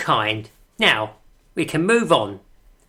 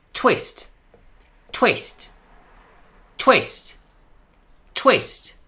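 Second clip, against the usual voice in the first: pompous poet performing his verse; poem recital; 2 semitones higher than usual. Acted.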